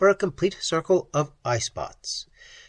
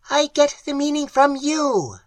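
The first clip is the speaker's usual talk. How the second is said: much higher, shrill creature voice; shrill clicking; 13 semitones higher, 4 dB louder than usual. Acted. Creature correcting Pat, shrill clicking voice.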